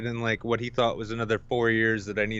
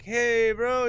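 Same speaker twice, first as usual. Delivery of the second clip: higher casual greeting; mock greeting; 11 semitones higher than usual. Greeting the dealer, high mock voice. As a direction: greet him like an old buddy.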